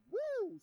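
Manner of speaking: high whoop of joy; a loud joyful whoop from the pulpit; excited whoop. Real, not acted. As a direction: let out a loud celebratory woo. Preacher whoops after a point.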